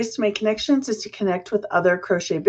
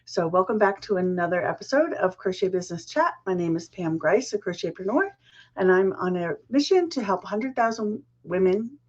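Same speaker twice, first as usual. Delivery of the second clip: normal talking voice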